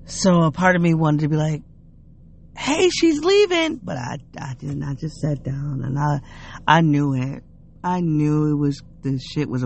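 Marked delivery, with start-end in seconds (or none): 2.6-3.8 high, drawn-out alert; high sing-out heads-up, not in earnest; mock call-out. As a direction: pretend to call out a warning, slow and pitched way up. Imagined calling out a warning.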